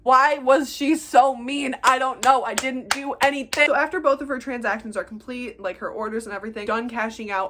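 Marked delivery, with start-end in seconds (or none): 0.0-3.7 loud, drawn-out mock wail; loud, hammed-up bewilderment; exasperated complaint. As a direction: wail it slowly in baffled protest. Mock wail over rude customer.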